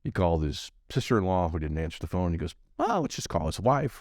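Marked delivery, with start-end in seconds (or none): 2.8-4.0 higher-pitched, offhand retelling; breezy no-big-deal quote; mock casual. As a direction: shrug it off in a lighter, higher voice. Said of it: "like it was no big deal".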